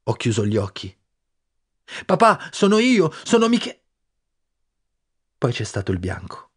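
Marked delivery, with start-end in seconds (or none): 2.1-3.8 high, loud, slow cry cut short; boy calling to his father before the shot; desperate call. Acted. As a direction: call out to him, then break off.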